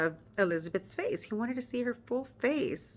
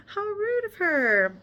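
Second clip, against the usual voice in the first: mock outrage; 11 semitones higher, 4 dB louder, slower than usual. Mock outrage at rude aunt.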